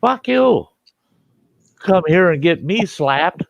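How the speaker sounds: high-pitched mock outrage; imitated indignation. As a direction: blame the stranger as the smacked son. Slapped son blames the visitor.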